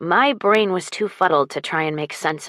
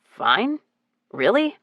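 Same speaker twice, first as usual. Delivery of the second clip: rising, disbelieving repeat of fine; incredulous echo; 4 semitones higher, slower than usual. Acted. Duke echoing her answer in mild disbelief. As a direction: throw the word back in mild disbelief. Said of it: "His eyebrows raised".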